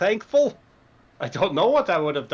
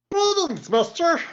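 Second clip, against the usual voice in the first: much higher, squeaky little creature voice; kobold greeting; 11 semitones higher than usual. Acted. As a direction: pipe up like a tiny saluting kobold. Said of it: "a kobold takes its stance and salutes".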